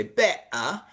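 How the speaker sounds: "better" with the T dropped entirely; British glottal stop. Shown as a demonstration. Teacher shows British option, "better" with the T left out. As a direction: break "better" in the middle with no T sound. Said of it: "get rid of the T together".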